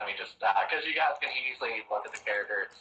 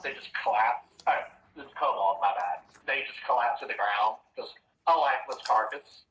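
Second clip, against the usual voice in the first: usual speaking voice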